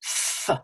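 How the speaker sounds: "f" as pure friction, no voice; breath-only "f" through teeth and lip; voiceless fricative "f"; unvoiced f. Shown as a demonstration. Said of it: "it's just fricative".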